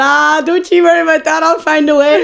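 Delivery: loud, high flirty comeback; giggly excited. Retold or imitated. Customer joking back to the seller, giggly and loud. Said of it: "me and her were giggling".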